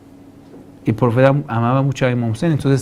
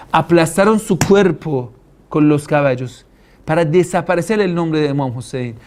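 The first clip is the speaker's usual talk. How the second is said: normal talking voice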